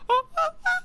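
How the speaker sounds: short, very high falsetto "ah"s; strained falsetto notes. Shown as a demonstration. Showing the lost top range, strained falsetto bursts. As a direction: reach for high notes with a damaged voice. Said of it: "my falsetto used to be a lot higher".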